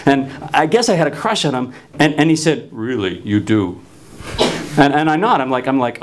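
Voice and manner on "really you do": deadpan teacher voice. Retold or imitated.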